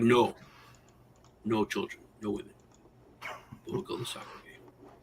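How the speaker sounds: sinking into a near-whisper; retold grave refusal. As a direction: solemnly rule it out, dropping to a hush. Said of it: "all serious".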